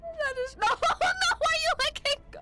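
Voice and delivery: very high, near-tearful panic; panicked crying shriek. Real, not acted. Viewer watching a climber let go, panicked shriek. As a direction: plead in a high, frantic wail.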